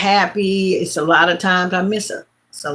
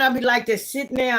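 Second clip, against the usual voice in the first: usual speaking voice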